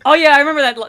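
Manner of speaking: loud burst of recognition; real delight at remembering; excited recognition. Real, not acted. Sudden recognition.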